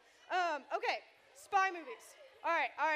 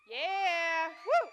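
loud high whoop for a friend; a real cheer from the crowd; 4 semitones higher, 15 dB louder than usual. Real, not acted. Youth leader cheering Vicky at church, loud whoop.

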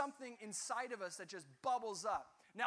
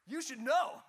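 much louder, higher, cheeky comeback; sassy retort; 4 semitones higher, 12 dB louder than usual. Acted. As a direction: toss it back at God with attitude. Cain retorts to God.

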